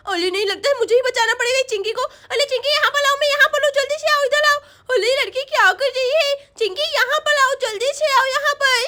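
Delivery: put-on lisping little girl calling out; urgent little Sonam. Girl calls friend to her hiding place, urgent child voice.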